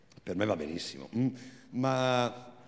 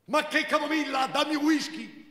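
much higher, thin, indignant woman's voice; Marina's thin voice; 12 semitones higher than usual. Acted. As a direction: snap back in a thin high voice. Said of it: "con quella sua voce sottile e inadeguata". (voce sottile e acuta, indignata)

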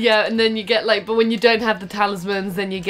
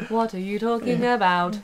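softer, even robot voice; 5 dB quieter than usual. Pretend. Robot answering a charisma insult, monotone robot voice.

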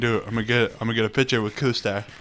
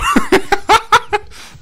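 high, loud burst of laughter; 10 dB louder than usual. Real, not acted. Gamer laughing at his Cold War pun, loud cackle. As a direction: crack up at your own joke.

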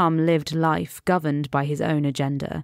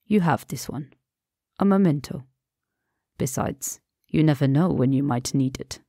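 calm Persian friend. Acted. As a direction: soothe him, lightly amused. Rassam gives a keepsake.

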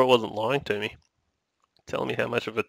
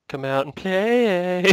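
taunting singsong; 7 semitones higher, much slower than usual. Pretend. Taunting call in the dark.

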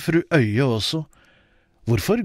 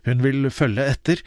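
usual speaking voice

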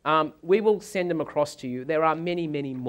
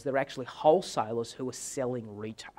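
hushed, secretive whisper; whispering a secret tip to husband; conspiratorial whisper; 4 semitones lower, 6 dB quieter than usual. Retold or imitated. Wife shares a secret deal.

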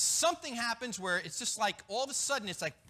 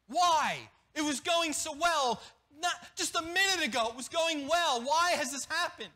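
higher, louder, slowed plea of why; raised grumbling protest, pitched up; voiced lament to God; 5 semitones higher, 5 dB louder than usual. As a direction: cry out in mock anguish at God. Preacher voicing complaint to God.

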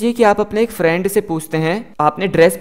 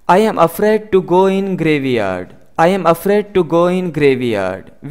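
very slow, careful English for learners; slow model sentence; much slower than usual. Shown as a demonstration. Teacher reading the example slowly to students. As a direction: spell each word out slowly for the class. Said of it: "teacher model sentence".

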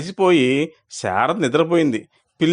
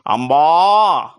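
a long, drawn-out, louder moo; calf mooing; 3 dB louder, much slower than usual. A narrator's reading. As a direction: stretch it like a calf calling for its mother. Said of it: "అని అరవమంది". (దూడలా సాగదీసి అంబా అని అరవడం)

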